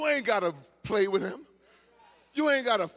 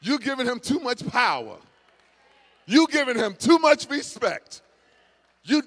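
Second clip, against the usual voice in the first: rising preacher shout, louder and higher; preaching at full force; 7 semitones higher, 9 dB louder than usual. Acted. Preacher rebuking the congregation, building to a shout. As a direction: build the rebuke until it rings out.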